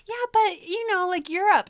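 high, loud mock mom; mom's voice. Daughter imitating mom's geography, high and loud. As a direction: mimic mom, sure of herself.

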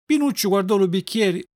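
ordinary delivery

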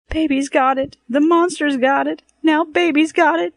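high, loud panicked screech. A narrator's reading. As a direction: shriek it through clenched teeth. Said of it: "Mabel screeched through her teeth".

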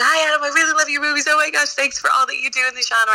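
fast, high, breathless fan gush; gushing fangirl. Retold or imitated. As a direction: gush like a starstruck fan meeting her idol. Retelling gushing to Adam as fangirl.

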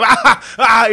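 high loud mock crowd laugh. Audience laughing at the heckler's line, loud and high. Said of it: "y la gente".

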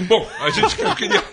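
high, bright showman announcing the guest; excited announcer voice. Acted. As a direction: announce him like a game show host.

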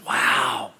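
breathless hushed wow; awed whisper. Retold or imitated. Obed-Edom seeing the ark, awed whisper. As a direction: whisper it in awe.

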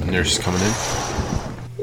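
slow, drawn-out stage announcement. Pretend. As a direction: announce it slowly like a narrator. Announcing nurse entrance.

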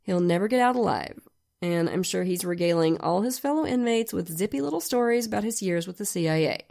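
usual speaking voice

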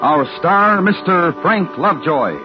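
ordinary talk, nothing marked

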